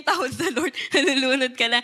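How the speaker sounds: ordinary delivery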